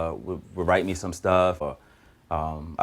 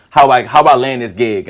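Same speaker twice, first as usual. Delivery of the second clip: loud, raised-pitch disbelief; incredulous outburst; 4 semitones higher, 12 dB louder than usual. Retold or imitated. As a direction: burst out amazed at your own luck. Retelling disbelief at landing gig.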